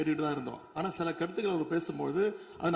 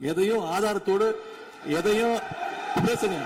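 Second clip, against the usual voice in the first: louder, higher insistence on எதையும்; forceful demand; 4 semitones higher, 5 dB louder, slower than usual. Real, not acted. Member challenging the minister in the assembly, loud and insistent. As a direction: hammer the word எதையும் with a raised voice.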